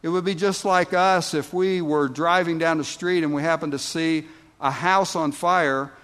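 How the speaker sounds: ordinary delivery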